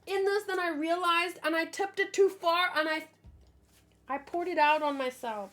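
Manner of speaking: blurting out a coffee accident; flustered spill report. Real, not acted.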